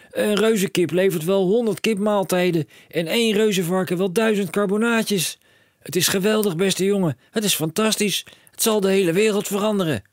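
high and loud, racing with plans; a dad who sees a fortune; excited cry. A narrator's reading. Father telling his son of riches, high excited call.

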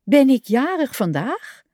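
a high, amazed question; astonished he forgot his own birthday; surprised. A narrator's reading. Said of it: "zei Kikker verbaasd".

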